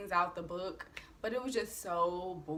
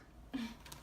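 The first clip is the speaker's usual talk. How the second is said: breathy laugh; 4 semitones higher, 11 dB quieter than usual. Real, not acted. Laughs at drink question.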